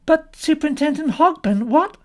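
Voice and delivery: high, loud call down the line; urgent protest. A narrator's reading. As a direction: try to stop the caller hanging up. Cut off by the caller.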